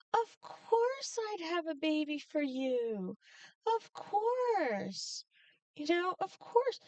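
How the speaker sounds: mock-gushing sister, pitched high; eager agreement. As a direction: play an over-willing sister, voice lifted high.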